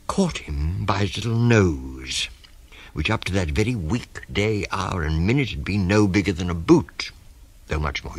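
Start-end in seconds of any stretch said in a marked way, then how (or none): none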